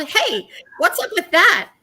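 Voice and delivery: calling up to the eagle, teasing indignation. Retold or imitated. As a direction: call up to the eagle overhead. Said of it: "I called".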